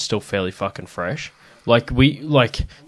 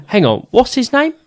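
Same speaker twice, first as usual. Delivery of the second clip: high-pitched puzzled query; acted-out sudden check, jumping up high; puzzled question; 14 semitones higher, 4 dB louder than usual. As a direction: stop short and ask, voice leaping up. Retelling a man pausing mid-signature.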